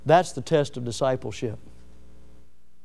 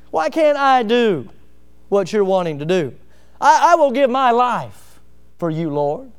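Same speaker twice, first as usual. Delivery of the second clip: Peter's bold vow; 6 semitones higher, 4 dB louder than usual. Retold or imitated. Peter insisting to the Lord, raised and pleading. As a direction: promise your life, pressing hard. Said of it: "Peter's response is".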